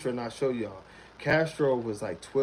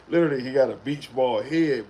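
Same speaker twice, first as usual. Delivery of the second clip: ordinary talk, nothing marked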